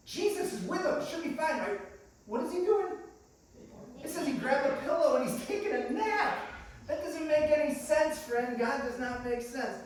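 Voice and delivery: high-pitched exasperated questioning; indignant disbelief. Acted. Preacher arguing why Jesus naps mid-storm, high and exasperated. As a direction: push the voice up in bafflement. Voicing the disciples' bewildered indignation.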